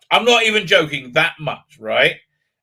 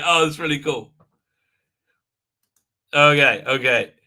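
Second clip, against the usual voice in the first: ordinary delivery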